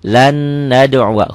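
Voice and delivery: slow "Lan nad'uwa" with a long humming n; idgham with ghunnah. Shown as a demonstration. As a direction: hold the n of "Lan" well before "nad'uwa". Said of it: "hukumnya idgham biguna".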